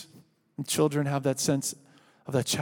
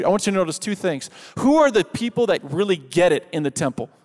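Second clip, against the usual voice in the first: preaching at full force; 3 semitones higher, 4 dB louder than usual. Acted. Preacher rousing the standing congregation loudly. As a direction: push the point home with energy.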